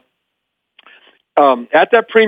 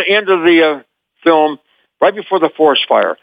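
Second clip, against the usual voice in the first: usual speaking voice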